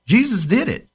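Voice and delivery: ordinary talk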